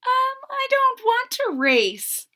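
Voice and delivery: high, hesitant little voice; shy. Acted. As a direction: shrink back as you say it. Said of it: "Trevor said shyly".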